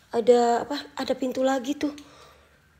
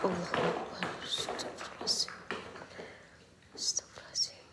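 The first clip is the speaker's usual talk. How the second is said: faint, breath-only invocations; whispered prayer; 5 semitones lower, 14 dB quieter than usual. Real, not acted. Ghost hunter praying alone, whispered. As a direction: whisper istighfar under your breath. Uneasy prayers in haunted place.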